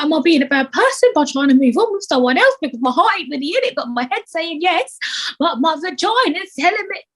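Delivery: high, quick fretting self-talk; anxious inner voice. Retold or imitated. Imagined inner monologue.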